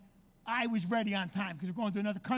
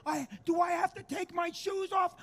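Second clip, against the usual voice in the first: higher-pitched mock whining question; clueless traveler; 7 semitones higher than usual. Comic mimicking people holding up security, clueless whine. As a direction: imitate a baffled passenger complaining. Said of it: "There's still people going".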